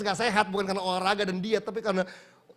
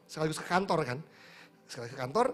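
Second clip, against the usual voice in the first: normal talking voice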